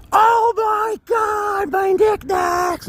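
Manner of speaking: very high, loud, drawn-out shriek; hammed-up wail of horror; horrified mom scream. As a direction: scream like a mother seeing her treasures crash. Mom's horrified reaction acted out.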